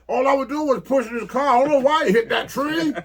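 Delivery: the prankster brushing off the crash, loud and high; mock Fuji protest. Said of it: "he probably thought that was great".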